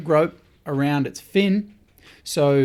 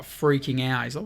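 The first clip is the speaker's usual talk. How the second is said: ordinary talk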